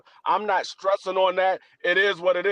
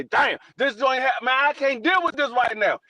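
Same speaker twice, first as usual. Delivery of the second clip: higher, slowed mock outburst of frustration; frustrated outburst; 6 semitones higher than usual. Man grumbling at a bad day, acted-out exasperated outburst. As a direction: blurt it out like a bad day is getting to you. Imagined frustrated self-talk.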